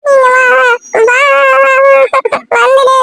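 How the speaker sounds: high, very drawn-out wail; crying child. Acted. Child begging her to come, wailing. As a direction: wail the plea, holding each vowel long. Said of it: "எதுக்குடா இப்படி அழுவுற?".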